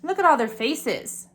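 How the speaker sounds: ordinary delivery, nothing marked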